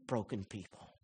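soft, near tears; moved preacher, voice cracking; choked up. Real, not acted. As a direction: let the voice break with feeling. Preacher moved at sermon close.

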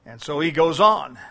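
normal talking voice

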